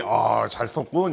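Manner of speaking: low, slow approving president; low, unhurried self-satisfied voice; pleased president. Retold or imitated. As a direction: mimic a leader savouring a report, low and drawn out. Imagined president approving a report.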